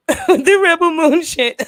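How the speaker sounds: slow words through laughter; laughing. Real, not acted. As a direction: laugh while saying it. Laughing at himself.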